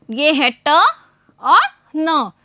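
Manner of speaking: slow and deliberate; slow teaching voice. Shown as a demonstration. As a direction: spell it out slowly for children. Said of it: "मैं आपको पढ़ के दिखा रही हूँ". (धीमी सिखाने वाली आवाज़)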